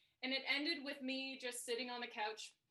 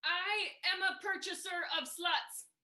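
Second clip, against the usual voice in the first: slow, higher and louder solemn declaration; deadpan pronouncement; 6 semitones higher, 6 dB louder, slower than usual. Retold or imitated. Husband improvising in a bar role play, slow and solemn. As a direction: announce it slowly like a formal title. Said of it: "he just goes".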